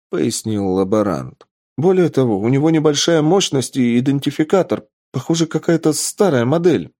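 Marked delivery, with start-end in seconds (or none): 1.8-6.9 higher, eager explanation; взволнованное пояснение. Acted. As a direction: explain it with excited urgency. Лаборант продолжает восторженный доклад. (взволнованно поясняя)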